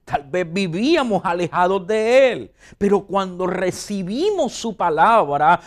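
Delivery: normal talking voice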